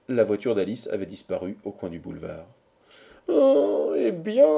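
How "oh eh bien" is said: high, loud wail of despair. A narrator's reading. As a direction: give in with a high, desperate cry. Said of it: "dit Quatercam désespéré". (plainte aiguë et désespérée)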